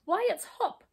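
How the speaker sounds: high-pitched amazed exclamation; astonished cry. A narrator's reading. Watching brownie recognising Hop, astonished cry. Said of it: "he cried in astonishment".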